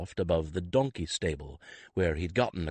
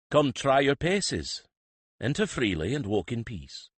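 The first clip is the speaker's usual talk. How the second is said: put-on a thoughtful old country priest, bargaining; rustic priest; 6 semitones higher, 4 dB louder than usual. Priest offering Skiff work and a letter, thoughtfully.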